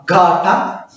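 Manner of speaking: "gata" with a long, stressed GA. Shown as a demonstration. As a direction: lean on the first syllable of "gata". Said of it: "we have to stress it like that".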